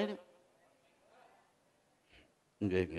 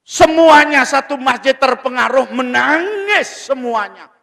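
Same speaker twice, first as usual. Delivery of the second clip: loud, high-pitched sermon voice; impassioned preaching; 8 semitones higher, 8 dB louder than usual. Real, not acted. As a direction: drive the story home to the congregation. Preaches at full force. (ceramah berapi-api dan lantang)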